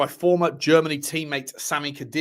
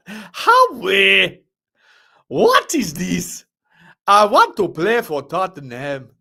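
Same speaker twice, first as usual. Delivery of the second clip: laughing out loud, higher and louder; 6 semitones higher, 7 dB louder, much slower than usual. Real, not acted. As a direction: crack up at the comment. Laughing at viewer comments.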